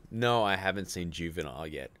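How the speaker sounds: normal talking voice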